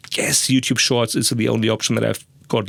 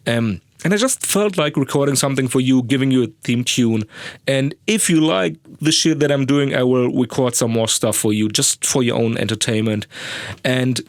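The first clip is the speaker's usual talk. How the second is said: ordinary talk, nothing marked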